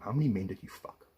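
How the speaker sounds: normal talking voice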